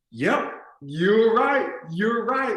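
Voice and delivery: high, drawn-out mock agreement; placating. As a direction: give in to mom, slow and high. Son placates his angry mother.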